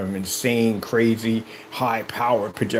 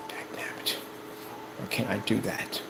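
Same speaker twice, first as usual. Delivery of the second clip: unvoiced, soft envious grumble; whispered mock envy; 12 dB quieter than usual. Mimicking a jealous naysayer, whispered and soft. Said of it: "getting upset".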